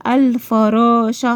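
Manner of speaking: الفراشة with a clear L and long vowels; pronounced lam. Shown as a demonstration. Teacher confirming the lam is pronounced, clear L in الفراشة. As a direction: deliberately sound the L in الفراشة. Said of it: "نطقتها حلو".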